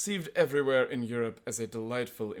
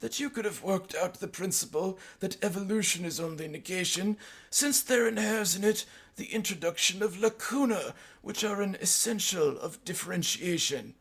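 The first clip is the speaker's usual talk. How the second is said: high-pitched mock academic; put-on high scholarly disdain; pompous professor; 8 semitones higher than usual. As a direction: lecture loftily in a high put-on voice. Mimicking rival professor in retold debate.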